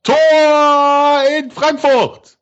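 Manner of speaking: high, slow, stretched goal shout; goal call. Real, not acted.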